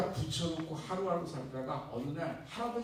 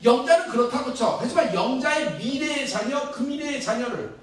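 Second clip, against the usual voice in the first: louder rousing sermon voice; preaching; 7 semitones higher, 10 dB louder than usual. Real, not acted. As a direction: challenge the congregation from the pulpit. Pastor preaches at full force. (힘차게 외치는 설교 톤)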